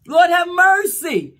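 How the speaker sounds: high, loud, slow plea; drawn-out loud prayer cry; heartfelt cry for mercy. Shown as a demonstration. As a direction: wail Lord have mercy from the gut. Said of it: "Sometimes we probably need to say".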